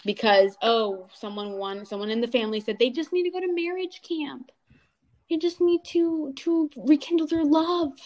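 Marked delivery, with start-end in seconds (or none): 2.8-7.9 mocking relative. Mocking a relative's naive advice.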